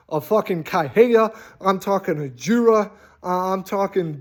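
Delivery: ordinary talk, nothing marked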